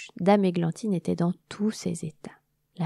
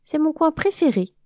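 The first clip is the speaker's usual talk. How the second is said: wasp voice; 8 semitones higher, 5 dB louder than usual. Acted. Wasp speaking in story.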